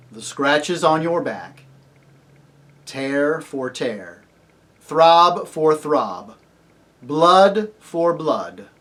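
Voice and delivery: very slow, measured pronouncement; solemn judgement. A narrator's reading. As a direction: weigh each phrase, building in force. Aslan pronounces stern judgement.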